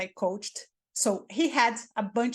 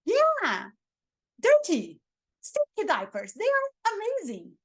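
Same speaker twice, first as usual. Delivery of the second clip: much higher, gushing with fake enthusiasm; mock excited; 5 semitones higher than usual. Shown as a demonstration. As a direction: rave about dirty diapers like a treasure. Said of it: "you can say anything in this tone of voice".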